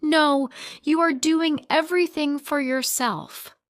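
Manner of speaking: high, loud furious retort; shouting back. A narrator's reading. Wife shouting back at husband during their fight. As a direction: throw it back in his face. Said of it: "I shout back".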